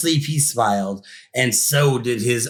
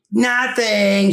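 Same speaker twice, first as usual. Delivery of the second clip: quick higher startled yelp; 7 semitones higher than usual. Acted. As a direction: blurt it out, caught off guard. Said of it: "he yelped".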